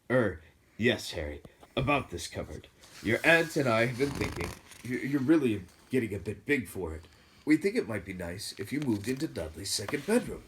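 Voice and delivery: strained, fake-pleasant uncle; forced friendliness. A narrator's reading. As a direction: force a painful smile into the voice. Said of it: "forced his face into a smile".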